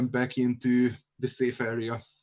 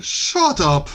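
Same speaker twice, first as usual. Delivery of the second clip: stern interruption; 7 semitones higher, 3 dB louder than usual. Acted. Club owner silences babbling intruder.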